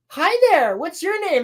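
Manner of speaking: high-pitched, sing-song hello; mock friendly greeting. Girl meets a Wookiee, mock friendly. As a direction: imitate a girl greeting a creature.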